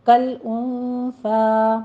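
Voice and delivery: کل انثیٰ slow, with no echo on the lam; کل انثیٰ drawn out with a nasal n; slow model of کل انثیٰ; tajweed pronunciation. Shown as a demonstration. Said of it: "قلقلہ نہیں کرنا".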